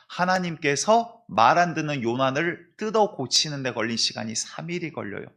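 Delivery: ordinary talk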